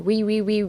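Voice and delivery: "re" repeated as separate beats; split syllables. Shown as a demonstration. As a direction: drill "re" alone to train the mouth. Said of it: "veとreで分けて".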